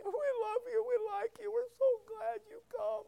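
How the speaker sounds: very high, mock-tearful granny voice; crying old lady. Nursing home ladies thanking their visitor, mock tearful. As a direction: gush in a high, put-on weepy voice. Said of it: "they're crying".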